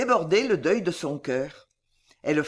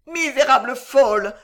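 high, loud anguished reproach; sanglot; 6 semitones higher, 7 dB louder than usual. A narrator's reading. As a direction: sob it out as an accusation. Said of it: "sanglota l'enfant". (reproche sangloté, aigu)

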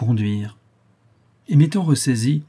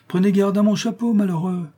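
high, cry out in tragic exclamation; hammed-up tragic actor's cry; grand tragic stage delivery; 9 semitones higher than usual. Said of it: "s'écria sur un ton tout différent alors cette voix tragique".

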